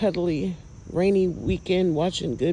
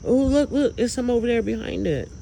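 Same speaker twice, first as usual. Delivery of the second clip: high and louder, eager pointing out; excited; 6 semitones higher, 3 dB louder than usual. Real, not acted. Filming lights outside, thrilled to spot more. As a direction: call out what you just spotted.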